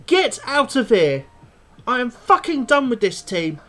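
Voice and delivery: loud, disgusted dismissal; fed up. Real, not acted. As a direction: spit it out, done with them. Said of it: "I'm absolutely seething".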